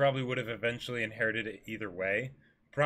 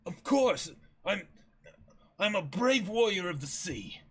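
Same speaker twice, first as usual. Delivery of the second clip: put-on higher, pressing young man's voice; Usopp, urgent warning; 5 semitones higher than usual.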